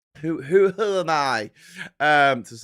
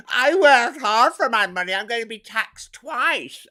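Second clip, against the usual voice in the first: higher, louder, slow indignant whine; mock rich whiner; 9 semitones higher, 7 dB louder, slower than usual. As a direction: whinge like a wealthy caller. Mocking rich caller's complaint.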